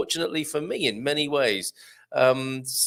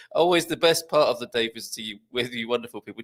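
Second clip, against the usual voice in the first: ordinary talk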